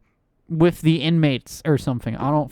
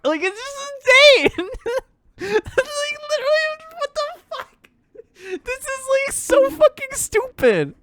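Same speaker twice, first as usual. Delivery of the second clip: squeaky high. Real, not acted.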